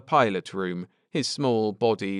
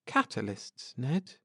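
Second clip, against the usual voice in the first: hushed fearful question; 3 semitones higher, 8 dB quieter than usual. Acted. Cynthia afraid, fear growing.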